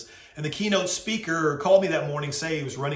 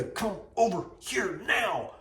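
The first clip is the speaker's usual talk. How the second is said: impatient beckoning; 4 semitones higher, slower than usual. Retold or imitated. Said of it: "kind of motion".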